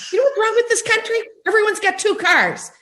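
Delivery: high, fast, hammed-up grumbling old grandpa; old grandpa griping. Actor imitating her Italian grandpa's rant, high and fast. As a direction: complain about the country like a cranky old grandpa. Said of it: "my old Grandpa Joey ... would always be like".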